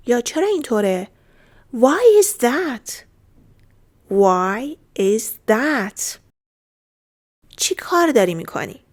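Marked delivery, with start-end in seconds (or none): 4.1-6.2 very slow, each word separated; deliberate language-lesson pace; slow model repeat. Shown as a demonstration. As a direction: say it one word at a time. Teacher repeats phrase slowly.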